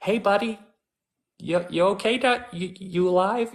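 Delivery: high, breezy hey buddy, you okay; casual check-in. Retold or imitated. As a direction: toss it off like a pal peeking into the den. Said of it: "I would have been like".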